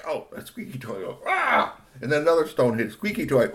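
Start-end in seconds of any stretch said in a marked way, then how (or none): 1.3-1.8 high-pitched cry of being hit; toy's yelp. A narrator's reading. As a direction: yelp sharply, voice jumping up. Toy cries when hit.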